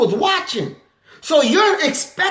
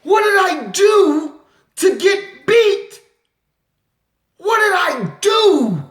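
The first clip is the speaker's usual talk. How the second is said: pleading victim; 7 semitones higher than usual. Retold or imitated. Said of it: "and she".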